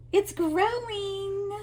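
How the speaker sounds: high, slow, marveling "It's growing"; sing-song wonder at the yarn project; delighted gasp. Real, not acted. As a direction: stretch out "growing" in happy amazement.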